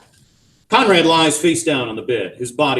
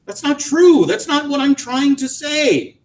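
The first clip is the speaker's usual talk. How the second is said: high, strained denial; insist, then slow down; protesting; 4 dB louder than usual. A narrator's reading. Husband protesting to wife, strained.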